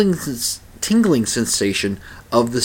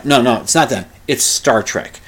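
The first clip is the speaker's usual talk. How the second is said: usual speaking voice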